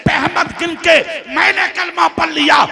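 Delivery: high, forceful declaiming, building; impassioned preaching. Real, not acted. Scholar revealing what his book foretold, preached with rising force. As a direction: build the revelation phrase by phrase.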